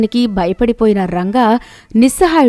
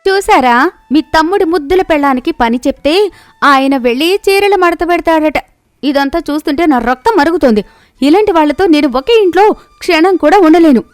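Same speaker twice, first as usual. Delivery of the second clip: boil over with outrage; wife raging at husband about his brother; angry; 7 semitones higher than usual. Acted. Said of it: "కాంతం కోపంగా".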